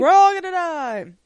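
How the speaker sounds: high, loud echoed wail; mock terror. Host joining the co-host's joke about doom, mock-panicked cry.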